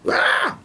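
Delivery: sudden very high shriek at a spider; woman's shriek. Shown as a demonstration. Preacher shows how a frightened woman shrieks, very high. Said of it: "ça va, elle crie".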